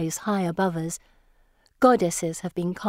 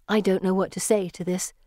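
plain reading voice

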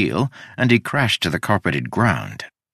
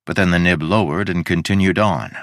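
usual speaking voice

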